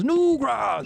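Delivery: high-pitched mock war cry; orc yell. Orc pumping up the brigand, mock yelling. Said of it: "other orcs yelling".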